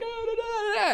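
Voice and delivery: very high, wailing babble; imitated tearful acting. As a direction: act out sobbing through the lines. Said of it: "started to cry and started to get into it".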